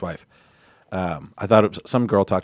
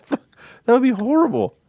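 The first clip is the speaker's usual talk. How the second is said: high pitched sympathy; 13 semitones higher, slower than usual. Real, not acted.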